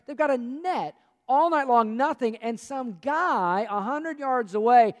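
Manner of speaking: rising, emphatic preaching build. Acted. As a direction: drive the point home to the congregation. Preaching at full force.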